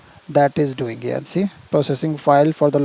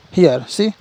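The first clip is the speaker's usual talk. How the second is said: usual speaking voice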